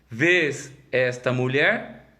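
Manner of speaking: drawn out, pointed question with a rise; slow emphatic question. Acted. Pastor voicing Jesus confronting Simon, slow and pointed. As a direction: lean into it slowly, confronting.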